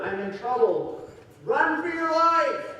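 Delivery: acted panic warning, climbing to a loud high call; mock alarm. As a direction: sound the alarm, drawn out and high. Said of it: "this whole feeling of danger".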